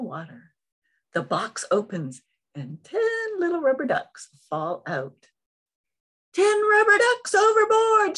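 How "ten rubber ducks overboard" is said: high, loud sailor's shout; shouting captain. Acted. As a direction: yell it out across the deck. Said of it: "shouts the captain".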